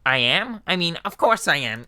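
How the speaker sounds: surprised then proud skeleton; Papyrus voice. Acted. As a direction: catch yourself and boast. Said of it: "Papyrus voice".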